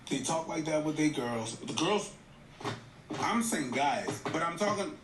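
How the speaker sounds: ordinary talk, nothing marked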